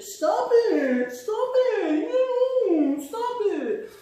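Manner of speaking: high, teary begging; crying plea. Acted. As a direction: whimper stop it through tears. Voicing crying bullied kid.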